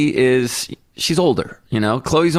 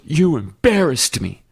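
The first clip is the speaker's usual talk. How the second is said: sulky daughter; 3 semitones higher, slower than usual. Retold or imitated. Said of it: "and she goes".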